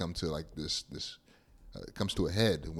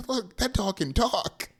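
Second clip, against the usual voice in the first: put-on higher, slow, amazed little boy; lightly voiced boy, pitched up and slow; Russell imitation; 13 semitones higher than usual. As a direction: point and gasp like an excited kid. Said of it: "he's like".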